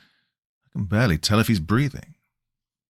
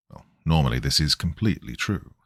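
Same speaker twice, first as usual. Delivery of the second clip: plain reading voice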